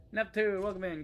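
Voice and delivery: loud, high, bright welcome call; excited greeting. Real, not acted. Greets arriving game companion.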